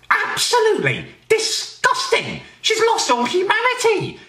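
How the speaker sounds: high-pitched scandalised shriek; outraged squeal. Acted. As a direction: squeal it in comic disgust. Outraged rant about public pooping.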